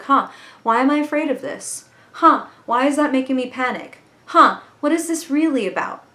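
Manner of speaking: bright, wondering questions; curious inner voice questioning a fear. Pretend. Voicing curious self-questioning.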